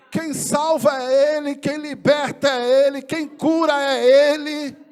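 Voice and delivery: loud, slow, building proclamation; preacher crying out who saves and heals; preaching at full force. Real, not acted. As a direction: thunder it out to the congregation. Preacher proclaiming God's power.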